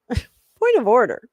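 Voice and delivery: pompous point of order, laughing; mock formal laughing. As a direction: play the parliamentarian with a laugh. Repeats the phrase mockingly, laughing.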